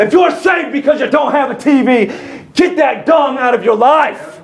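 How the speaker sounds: high, shouted pulpit exhortation; full-force preaching. Real, not acted. Preacher ordering church to throw out dead religion, fervent shouting. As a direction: cry out the command to the congregation.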